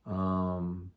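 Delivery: usual speaking voice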